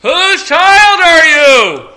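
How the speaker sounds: high, drawn-out holler across a distance; shouted demand. Acted. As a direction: bellow it slowly as a mock-clueless father. Father yelling back to screaming child.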